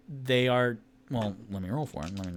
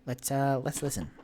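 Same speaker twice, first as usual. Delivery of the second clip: hushed character voice; 3 semitones higher, faster than usual. Acted. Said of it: "he's like".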